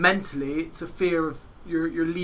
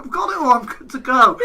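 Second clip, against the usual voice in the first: much higher, louder, proudly eager; cocky eager; 8 semitones higher, 9 dB louder than usual. Retold or imitated. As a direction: announce you're all set, overconfident. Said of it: "I was like".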